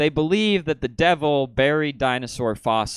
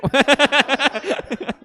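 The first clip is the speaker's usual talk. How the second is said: high giggle; high laugh; 6 dB louder than usual. Real, not acted. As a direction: giggle high at your own slip. Laughing mid-bit.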